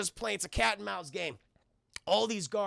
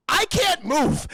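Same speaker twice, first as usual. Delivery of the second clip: loud, high cry of alarm; hammed-up helpless shout; panicked mock yell; 10 semitones higher, 10 dB louder, slower than usual. Streamer voicing Terry stuck in the suit, panicked.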